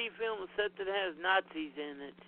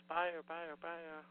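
fading echo; 4 semitones lower, 12 dB quieter, slower than usual. Pretend. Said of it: "Should I say a couple more times?".

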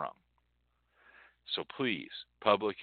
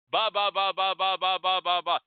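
loud high ba-ba-ba chatter; mocking babble; 10 semitones higher, 5 dB louder than usual. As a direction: imitate everyone yammering at once. Mimicking babble of voices.

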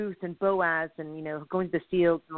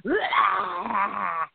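frustrated groan. Retold or imitated. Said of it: "I was just like".

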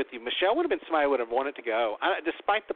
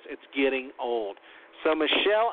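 normal talking voice

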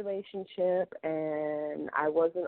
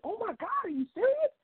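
loud surprise. Retold or imitated. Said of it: "And I said it really loud".

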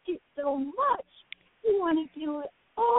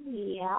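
low, soft meow like a sleepy cat; 11 semitones lower, 6 dB quieter than usual. Pretend. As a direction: give a soft little goodnight meow. Playful goodnight to children.

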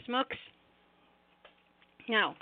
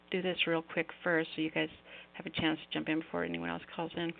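ordinary talk, nothing marked

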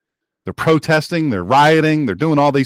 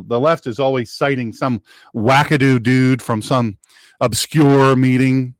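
normal talking voice

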